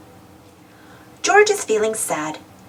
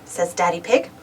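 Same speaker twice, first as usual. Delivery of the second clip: plain reading voice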